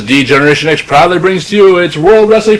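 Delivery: booming ring announcer intro; grand entrance announcement. Retold or imitated.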